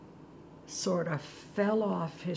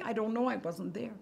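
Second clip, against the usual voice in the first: usual speaking voice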